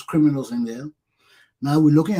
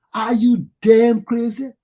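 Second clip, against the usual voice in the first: slow, louder angry question; outraged shout; 5 semitones higher, 6 dB louder, slower than usual. Real, not acted. Demanding if people are mad, slow angry shout. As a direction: spit it out in disbelief.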